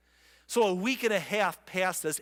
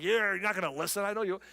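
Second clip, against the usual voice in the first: 1 quick mock Jeremiah sarcasm; 3 semitones higher, much faster than usual.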